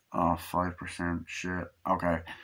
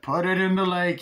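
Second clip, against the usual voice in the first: put-on urging; 8 semitones higher, 4 dB louder than usual. Acted urging to dump rat.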